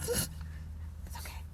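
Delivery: slow breathy whisper; slow mock-tender whisper; whispered comfort. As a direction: barely breathe the words. Whispered comfort, then joke.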